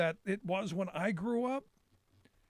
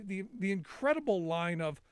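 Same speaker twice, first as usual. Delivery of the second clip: usual speaking voice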